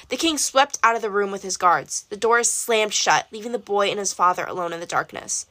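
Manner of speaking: plain reading voice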